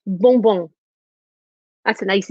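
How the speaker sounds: ordinary talk, nothing marked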